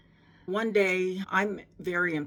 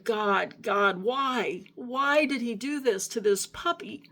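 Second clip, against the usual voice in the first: high, pleading cries of why to God; reliving a desperate plea, pitched well up; retold crying plea; 6 semitones higher than usual. Begging God why over a puppy, high anguished plea. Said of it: "You know, and I'm crying".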